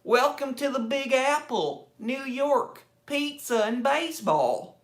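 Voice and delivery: mock a foreigner's overdone, drawn-out American twang; bad American impersonation. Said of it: "does an American impersonation, they're like".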